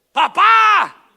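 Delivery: high shouted cry of disappointment; boy shouting at dad over toy car, high mock yell. Son yelling at dad over toy car, hammed-up high shout.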